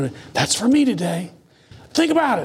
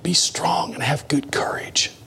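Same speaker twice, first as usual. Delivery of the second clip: slow, hushed whisper; slow whisper of the verse; 4 dB quieter, slower than usual. Shown as a demonstration. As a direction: breathe the words slowly and softly. Preacher repeats verse whispering.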